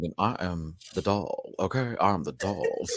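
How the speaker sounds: slow, low, a hint of Relly; Relly impression. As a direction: drawl it out as Relly. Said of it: "if I'm doing your voice".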